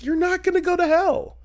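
very high, sing-song Southern reassurance; high campy Southern voice; Leslie Jordan voice. Retold or imitated. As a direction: soothe him in a Leslie Jordan lilt. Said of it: "He sounded like Leslie Jordan".

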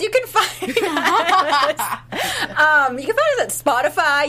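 giggling through the words; laughing. Real, not acted. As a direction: laugh your way through the plug. Laughs while plugging the show.

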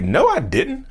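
high-pitched retold denial; acted-out indignant no; protesting denial.

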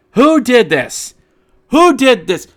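high, loud indignant outcry; outraged. Pretend. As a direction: demand to know who did this. Outraged at the schedule.

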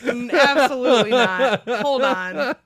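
loud startled no, no way; alarmed refusal. Retold or imitated. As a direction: hold up, absolutely not. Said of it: "I asked, like".

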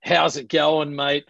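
ocker Australian greeting; broad Aussie accent. Shown as a demonstration. Australian guest showing the host a typical Aussie, broad ocker accent. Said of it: "the typical Aussie sounds a little bit more like".

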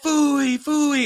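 high, drawn-out mock command; mock yell. Officer giving the dog the bite command, high mock yell. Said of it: "yelling at the top of my lungs".

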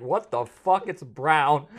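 loud, high, drawn-out disbelief; shocked yell. Retold or imitated. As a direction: blurt it out stunned at the sight. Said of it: "I was like".

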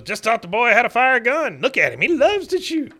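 high, loud, crowing cartoon father; excited retold boasting. Father shows off baby with pistol, excited boasting.

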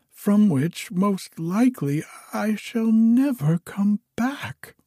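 high-pitched, distressed princess; sobbing plea. A narrator's reading. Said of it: "and sobbed out".

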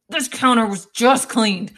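slow, loud, fed-up scolding; exasperated scolding. Retold or imitated. Wife scolding husband over pickle juice, slow loud exasperation. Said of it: "I'm like".

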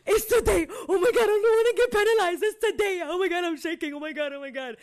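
high, loud frantic mother; panicked mom fearing a penalty; hammed-up freak-out; mom in a fit. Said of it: "a full fucking fit".

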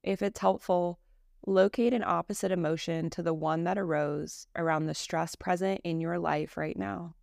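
calm, measured meditation voice with long pauses; guided meditation. Acted. Teacher leading a listener through Yoga Nidra practice, guided meditation. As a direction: guide them unhurriedly, settling each phrase into silence.

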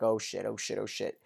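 low, very quiet panic, retold; muttered dread. Host voicing prisoner realizing the danger, low muttered dread. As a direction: mutter it under your breath as the prisoner.

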